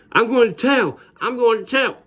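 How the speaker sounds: mock a bratty kid threatening to tell; whiny kid voice. Bully whining he'll tell teacher.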